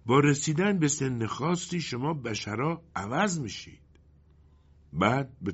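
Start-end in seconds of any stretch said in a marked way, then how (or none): none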